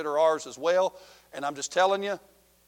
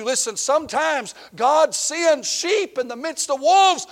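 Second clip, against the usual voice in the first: high, loud, slow sermon climax; preaching at full force; 12 semitones higher, 10 dB louder, slower than usual. Real, not acted. Preacher tells the congregation God sends sheep, slow loud preaching. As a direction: drive the point home to the congregation.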